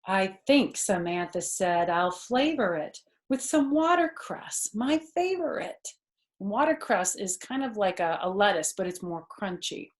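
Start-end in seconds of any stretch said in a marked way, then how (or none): 0.1-5.9 put-on lively read-aloud for a child; animated children's story narration; storybook reading. A narrator's reading. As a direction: read it like a bedtime picture book. Reading picture book aloud.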